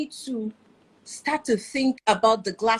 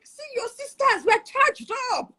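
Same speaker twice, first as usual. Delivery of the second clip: retold high-pitched battle cry; fired up; 13 semitones higher than usual. Wife retelling how she riles calm husband, fired up.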